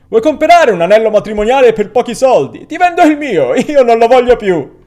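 loud, high heckling call; mocking shout. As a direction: rib the groom-to-be from across the street. Man calling out from the sidewalk.

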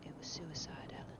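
tense whisper of a dreadful secret; very quiet. A narrator's reading. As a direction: whisper it so no one else hears. Dorian's whispered answer.

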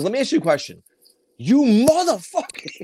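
mock blow-up at a scam caller; a playful imitated screaming fit; retold yelling at callers. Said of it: "I start screaming at them".

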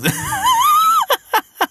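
high shrieking laugh; cracks up hard at the memory. Real, not acted. Remembering funny movie scene.

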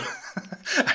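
short scoffing laugh. Real, not acted. Podcaster reacting to a bank's email, short indignant laugh. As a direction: laugh it off in disbelief.

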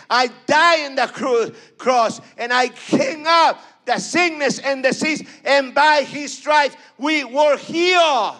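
loud, high cry of faith; crying out at full voice; shouted preaching. Acted. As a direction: shout it out to the congregation. Voicing God at peak of sermon.

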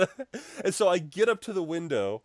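normal talking voice

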